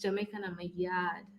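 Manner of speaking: softly modelled Jamaican patois; Jamaican accent. Shown as a demonstration. Partner demonstrates the phrase after teasing, soft Jamaican accent.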